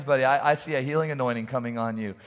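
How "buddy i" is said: ordinary talk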